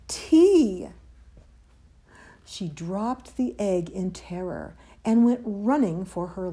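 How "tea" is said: high, loud startled cry; startled alarm. A narrator's reading. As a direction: cry out as if caught by a predator. Comp halts before dropping egg in terror.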